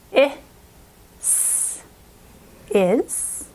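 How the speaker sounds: is split into its sounds, then blended; slow beginner's decoding of is; sounding out. Shown as a demonstration. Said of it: "let's now start reading".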